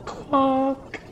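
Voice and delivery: high squeaky quack; [name] duck quack. Shown as a demonstration. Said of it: "Oh, I quite like that".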